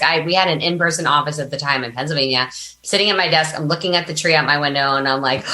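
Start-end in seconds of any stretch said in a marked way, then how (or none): none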